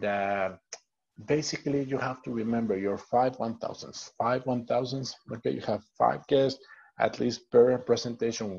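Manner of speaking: ordinary delivery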